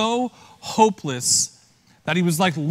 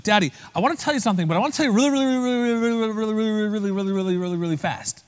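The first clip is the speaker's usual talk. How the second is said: hurried little daughter, words tumbling out higher; rapid-fire child; 4 semitones higher, much faster than usual. Retold or imitated. Daughter bursting to tell her daddy something important, rapid-fire. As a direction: race through a breathless string of reallys. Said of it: "slow down for one second".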